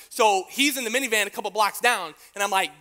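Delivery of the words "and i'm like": usual speaking voice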